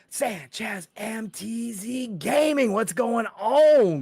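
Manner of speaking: slow, high, stretched name call; hyped shout-out. Real, not acted. Host welcoming a gamer in chat, hyped and drawn out. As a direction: draw the handle out into a big greeting.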